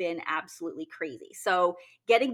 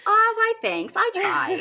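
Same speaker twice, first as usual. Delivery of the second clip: high, loud bubbly service voice; customer service voice; 13 semitones higher, 7 dB louder than usual. Pretend. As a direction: beam like you're greeting a client. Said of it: "It's my customer service voice".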